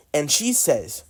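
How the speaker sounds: ordinary talk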